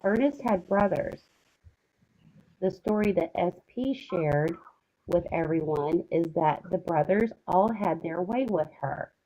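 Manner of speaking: ordinary talk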